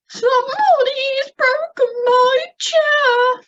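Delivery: high, slow tearful little bear; sniffling Baby Bear. Shown as a demonstration. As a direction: sniffle it out, upset and small. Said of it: "He's sniffling now 'cause he's rather upset".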